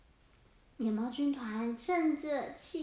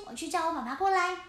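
fast, eager little penguin; hurried penguin; 2 semitones higher, 3 dB louder, much faster than usual. Acted. Little penguin hurrying to fetch dad, fast and eager. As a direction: rattle it off and run.

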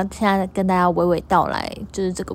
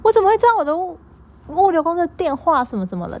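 imitating a furious customer, pitched way up; indignant customer; 10 semitones higher, faster than usual. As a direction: squeal out the customer's indignant protest. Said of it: "他说".

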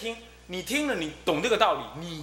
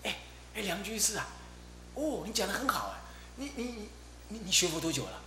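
secretive hushed question; 5 semitones higher, 7 dB quieter than usual. Retold or imitated. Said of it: "跑来偷偷问我".

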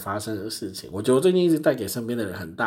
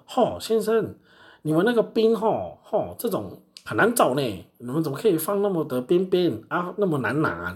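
mock loud, higher griping; loud complaining customer; 7 semitones higher than usual. Customer scolding the clerk, mock loud complaint. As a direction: play the pushy customer complaining loudly. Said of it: "然后就很大声讲说".

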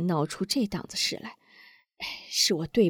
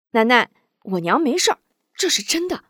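higher, loud cheerful insisting; smiling reassurance; 5 semitones higher, 5 dB louder, faster than usual. A narrator's reading. Wu Zhong reassures Granny, lightly voiced youth. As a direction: laugh it off and insist. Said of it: "吴忠笑道".

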